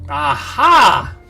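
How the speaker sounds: normal talking voice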